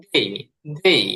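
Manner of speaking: they ending in a short i glide, said separately; corrected pronunciation. Shown as a demonstration. Teacher modelling for learners, they with a little i at the end. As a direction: close each they on a slight i sound. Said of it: "একটুখানি রস হবে শেষে গিয়ে".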